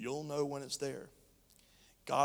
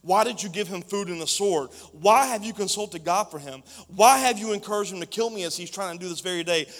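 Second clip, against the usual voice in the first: louder, higher string of accusing questions; angry demands; 5 semitones higher, 11 dB louder than usual. Acted. Saul interrogates the priest, louder and angry. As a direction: hammer him with each why. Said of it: "Saul demanded".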